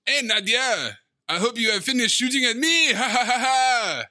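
hammy villain with a laugh; hammed-up French villain. Imitating the character's entrance.

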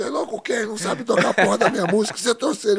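bronca encenada, aguda e alta; pai bravo imitado. Retold or imitated. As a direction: imite um pai indignado, meio rindo. Pai xinga o filho pelo baixista. (bronca de pai, exagerada e cômica)

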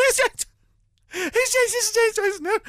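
high, loud incredulous squawk; acted exasperation. Telling clueless stunt men about Joyce, acted exasperation. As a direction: repeat it loudly, aghast they don't know.

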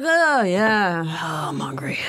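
laid-back dude imitation, trailing into a near whisper; Joe. Actress imitating her co-star being a dude, lazily fading voice. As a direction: play an easygoing dude, fading away. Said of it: "he's a dude".

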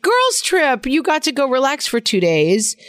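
high mocking husband quote; imitating husband. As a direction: tease his dismissive tone. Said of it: "to my husband's eye, it was like".

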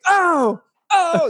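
two quick yelps as the tooth zings; imitating pain groans. Acting out pain on live stream.

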